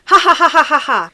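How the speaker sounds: loud, spelled-out fake laugh; hammy acted laugh; fake laughter. A narrator's reading. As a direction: force out a run of ha-has. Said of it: "I can't help but laugh too".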